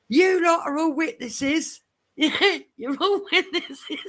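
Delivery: slow, high-pitched declaration to everyone; mock outraged appeal. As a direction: proclaim it high and drawn out, amused underneath. Acted appeal to the whole ward.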